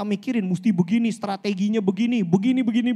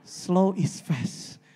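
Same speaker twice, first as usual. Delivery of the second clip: very slow, softer quote of Bo; 5 semitones lower, 7 dB quieter, much slower than usual. Retold or imitated. Quoting his slow-speaking mentor Bo, very slow and soft. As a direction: draw each word out like a calm mentor. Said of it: "Emang dia kalau ngomong lambat sih".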